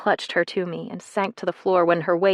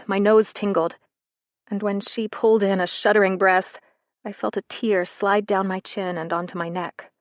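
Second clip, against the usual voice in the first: usual speaking voice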